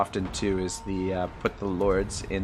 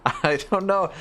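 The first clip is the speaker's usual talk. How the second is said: laughing; 11 semitones higher, 5 dB louder than usual. Real, not acted. Laughing after chat teases pronunciation.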